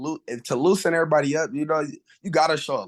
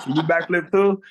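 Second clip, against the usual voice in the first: put-on excited kid asking; 6 semitones higher, 5 dB louder than usual. Kids asking the boy for tricks.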